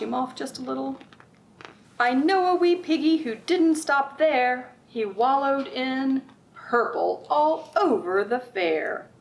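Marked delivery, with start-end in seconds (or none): 2.0-9.1 sing-song picture book read-aloud; lilting, rhythmic storybook voice; reading to children. Acted. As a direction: read it like storytime for little ones.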